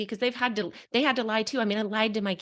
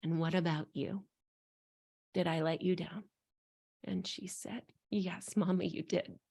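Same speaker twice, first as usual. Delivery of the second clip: soft gentle asking; 7 dB quieter than usual. Retold or imitated. Mother gently asking little daughter.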